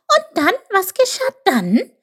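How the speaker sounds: high, loud, pressing little voice; urging. A narrator's reading. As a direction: push eagerly for the next part, very high. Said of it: "drängelte Chip".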